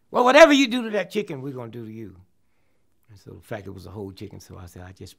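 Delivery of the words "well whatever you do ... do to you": high, loud, fast hostile demand; hammed-up Klansman confronting a diner; mock angry Klansman. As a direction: get in his face. Klansman threatens the narrator.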